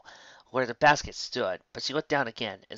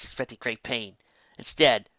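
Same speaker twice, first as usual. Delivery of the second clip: ordinary delivery, nothing marked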